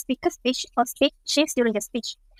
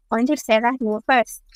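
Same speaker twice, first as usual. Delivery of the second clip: usual speaking voice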